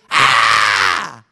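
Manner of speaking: a raw, very loud scream of pain; a piercing cry of anguish from Job. Shown as a demonstration. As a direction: let out a full scream as if hurt. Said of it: "Wasn't that uncomfortable to hear?".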